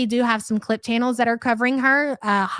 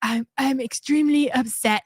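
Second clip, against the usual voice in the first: mock dramatic. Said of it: "Listen to the dramatics of it all".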